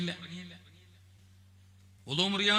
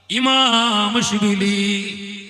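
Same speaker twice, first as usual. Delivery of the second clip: ഇമാം Shibili drawn out very slowly and called louder; 3 semitones higher, 9 dB louder, much slower than usual. Shown as a demonstration. Preacher calling the saint's name out for the crowd to repeat, loud and drawn out. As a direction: stretch out ഇമാം Shibili loudly. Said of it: "ഉറക്കെ പറ".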